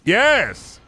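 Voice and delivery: high, loud cheesy cheer; mock excited boss. Streamer as boss announcing pizza party, hammed-up cheer.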